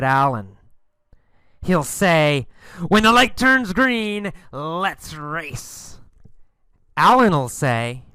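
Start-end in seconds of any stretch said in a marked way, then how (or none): 2.9-4.3 higher-pitched, sneering dare; challenging rival. Acted. Tom sneers at Alan at the stoplight, challenging. 4.5-6.0 softer sneering challenge; challenging rival. Acted. Tom challenges Alan to race, softly sneering.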